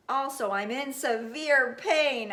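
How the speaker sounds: loud, slow pained shout; loud slow cry of pain. Acted. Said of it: "I shouted".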